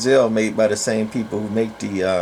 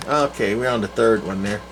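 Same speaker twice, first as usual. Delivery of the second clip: ordinary talk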